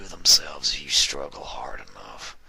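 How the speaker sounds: hushed, knowing whisper; smiling whisper. A narrator's reading. Captor whispering to the bound mare, smiling whisper. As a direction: whisper it with a sly smile. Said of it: "he said with a smile".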